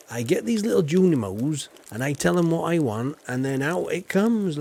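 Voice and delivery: ordinary talk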